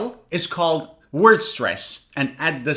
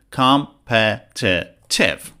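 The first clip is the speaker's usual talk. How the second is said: "competitive" slowly split into four beats; slow, separated syllables in "competitive"; 7 semitones lower, much slower than usual. Shown as a demonstration. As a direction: say "competitive" with a pause after each syllable. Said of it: "how many syllables".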